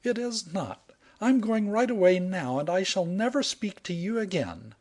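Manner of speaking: high, indignant woman's voice; huffy heroine. Acted. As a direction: flounce off in a temper. Heroine storming off.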